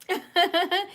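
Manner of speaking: quick, high giggle; real laugh. Real, not acted. Vlogger joking with a viewer about being related, high giggle. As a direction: laugh at your own little joke.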